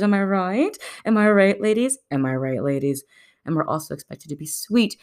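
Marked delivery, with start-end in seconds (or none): 0.0-2.0 higher, perky call to the ladies; rally the ladies with a raised voice; mock rallying. Praising women as mothers, mock perky rallying. 2.1-3.0 lower, understated aside; low deadpan repeat. Pretend. Nudging the ladies again, low mock aside.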